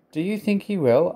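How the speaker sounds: high, eager storybook question; hopeful. A narrator's reading. Trevor asking Jem if he'll be bought, hopeful. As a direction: ask with lifted, tentative hope. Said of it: "asked Trevor hopefully".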